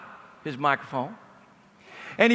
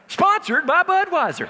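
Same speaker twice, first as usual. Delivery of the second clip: laughing punchline; 9 semitones higher, 4 dB louder, slower than usual. Real, not acted.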